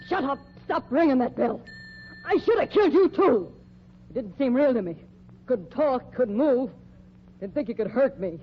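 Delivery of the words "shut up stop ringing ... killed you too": angry outburst. Acted. Cornered killer lashes out.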